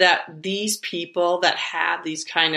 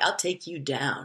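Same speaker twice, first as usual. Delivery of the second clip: mock threat; 5 semitones lower, 8 dB quieter than usual. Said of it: "And I'm like".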